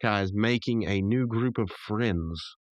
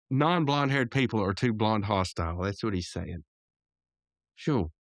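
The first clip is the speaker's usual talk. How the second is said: ordinary talk, nothing marked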